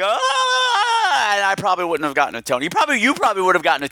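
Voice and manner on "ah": high hammed-up yelp of fright; a mock terrified cry; mock panicked shriek. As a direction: squeal like you're scared off. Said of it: "I would have been like".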